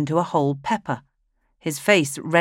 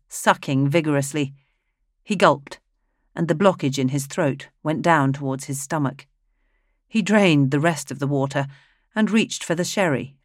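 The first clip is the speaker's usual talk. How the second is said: ordinary delivery, nothing marked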